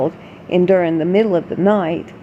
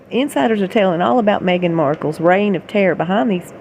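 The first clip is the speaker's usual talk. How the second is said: normal talking voice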